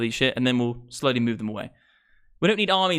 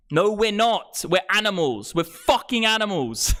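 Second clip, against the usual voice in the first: high, emphatic rant; worked-up streamer insisting at high pitch; screaming into mic; 7 semitones higher, slower than usual. Real, not acted. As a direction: cry it out, drawing out the last line. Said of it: "we're screaming into the mic again".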